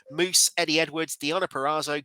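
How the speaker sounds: normal talking voice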